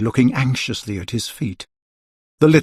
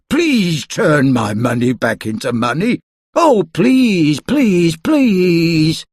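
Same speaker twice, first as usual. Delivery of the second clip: rising to loud pleading; begging; 5 semitones higher, 3 dB louder, slower than usual. A narrator's reading. Mister Stingy begging the wizard, pleading. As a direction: beg harder on each please. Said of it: "he begged".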